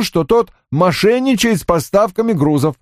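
high, matter-of-fact accusation; subordinate reporting. Acted. Johnny's report, then echoed as question. (высоким голосом докладчика)

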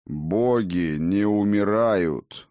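booming pronouncement of a god; loud declaration. A narrator's reading. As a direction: ring it out, each word drawn long. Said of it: "громко бросил отец дружин".

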